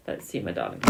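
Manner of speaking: low, soft musing over the cards; low murmur. Real, not acted. Tarot reader to viewer, a low hushed murmur. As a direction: murmur it to yourself, deep and hushed.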